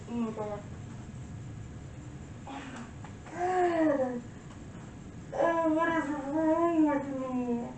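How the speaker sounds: slow, high, drawn-out wail; distraught. Acted. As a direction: stretch the words out in anguish. Monologue, composer breaking down.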